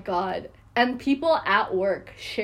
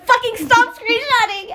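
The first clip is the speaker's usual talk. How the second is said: half-laughing shout, pitched up high and loud; exasperated yell; 11 semitones higher, 6 dB louder than usual. Retold or imitated. Yelling at people screenshotting his Snapchat, loud and exasperated. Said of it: "And I'm like".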